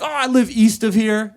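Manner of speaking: evasive. Retold or imitated. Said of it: "I was just like".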